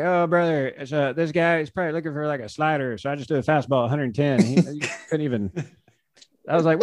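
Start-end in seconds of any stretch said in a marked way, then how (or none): 0.0-4.4 easygoing pitcher explaining, pitched up; Josh Hader impression. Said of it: "that's totally what he sounds like".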